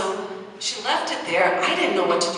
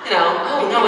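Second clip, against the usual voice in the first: usual speaking voice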